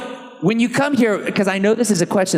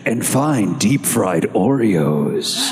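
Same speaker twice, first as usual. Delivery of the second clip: low, slow trailer announcer; movie trailer voice; 7 semitones lower than usual. Retold or imitated. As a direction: boom it out like an epic trailer. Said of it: "[name]'s got a good trailer voice".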